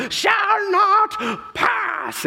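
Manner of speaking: very slow, high shouted wizard impression; Ian McKellen as Gandalf. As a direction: theatrically bellow each word like a wizard barring the way. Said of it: "in the Ian McKellen accent".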